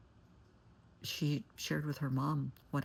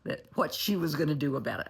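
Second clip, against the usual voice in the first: fired up; 6 dB louder than usual. Retold or imitated. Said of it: "then she got fired up".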